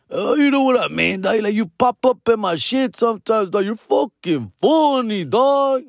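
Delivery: imitated drunk guy, words stretched at the end; drunk fan impression. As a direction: gush like a tipsy fan. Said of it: "They were kinda drunk".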